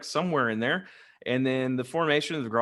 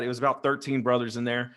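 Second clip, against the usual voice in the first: usual speaking voice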